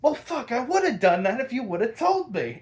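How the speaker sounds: high, loud put-on Harley voice; Harley snapping back. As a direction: shrug it off in her ditzy way. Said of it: "And she's like".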